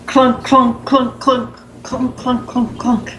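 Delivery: rhythmic clunk clunk chant; clunking footsteps. Pretend. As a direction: mimic heavy footsteps. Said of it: "sound of running back".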